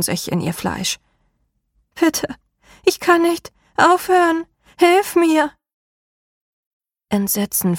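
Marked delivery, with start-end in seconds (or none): 1.9-5.5 high, strained begging cry; desperate plea. A narrator's reading. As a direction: beg for your life. Woman screaming, begging for help.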